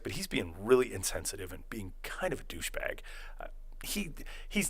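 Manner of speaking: hushed whisper, as if confiding; a quiet whisper behind Ray's back; retold whisper. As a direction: lean in and whisper the complaint. Confiding aside to the girl.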